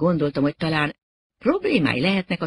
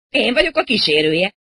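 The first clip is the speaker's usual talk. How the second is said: high, loud self-introduction; emphatic announcement; 7 semitones higher, 7 dB louder than usual. Acted. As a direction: declare it loudly and proudly. Rocker insists he is the escort.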